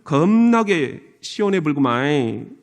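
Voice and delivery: slow, drawn-out Jeolla accent; leisurely Jeolla lilt; Jeolla dialect. Shown as a demonstration. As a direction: stretch it like a Jeolla local. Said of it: "전라도는".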